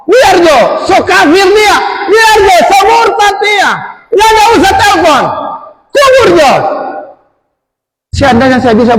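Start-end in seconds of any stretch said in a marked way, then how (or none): 0.1-6.5 high, loud shouted condemnation; imitating furious aunts. Aunts on the phone scolding the son who stays away, furious high shouting. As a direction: shout it up high like outraged relatives damning him. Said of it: "kembalilah kemarahan mereka. Meluap-luap".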